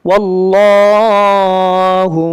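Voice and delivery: slow, drawn-out chanted recitation; slow Quran recitation. Shown as a demonstration. Teacher recites the verse to students, slow melodic chant. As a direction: chant the verse slowly, vowels stretched.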